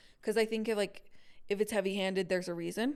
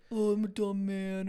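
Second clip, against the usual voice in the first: put-on dopey man's voice; dumb man voice. Shown as a demonstration. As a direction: badly fake a dumb man narrating. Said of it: "let me hear you do a man's voice".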